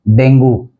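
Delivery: डेंगू as DENG-goo; डेंगू with a final "goo"; common mispronunciation. Shown as a demonstration. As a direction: end डेंगू on "oo". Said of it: "उच्चारण भी गलत लिखा".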